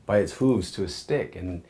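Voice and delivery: normal talking voice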